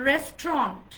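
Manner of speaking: restaurant in two syllables, "RES-tront"; silent U. Shown as a demonstration. Teacher revealing the pronunciation with silent U, corrected model. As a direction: drop the U in restaurant and end it "-tront". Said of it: "just make the ইউ letter silent".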